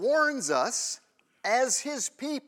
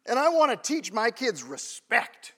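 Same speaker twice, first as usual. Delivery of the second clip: ordinary talk, nothing marked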